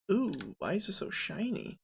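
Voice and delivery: usual speaking voice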